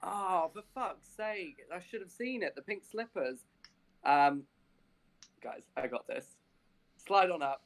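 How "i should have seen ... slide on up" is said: ordinary talk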